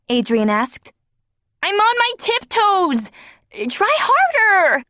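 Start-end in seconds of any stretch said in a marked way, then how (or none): 1.6-4.8 high straining call. A narrator's reading. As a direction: call back on tiptoe, strained. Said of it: "Ozzy called back".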